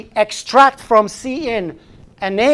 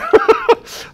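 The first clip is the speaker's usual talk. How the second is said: quick breathy laugh at his own joke; a brief amused laugh; short laugh; 3 dB louder than usual. Real, not acted.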